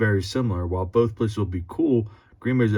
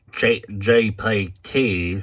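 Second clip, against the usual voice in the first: mock robot voice; much slower than usual. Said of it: "stop talking like that".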